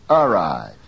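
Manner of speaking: ARRIVE wrongly stressed on a full A; stress moved to first syllable. Shown as a demonstration. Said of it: "from the second to the first".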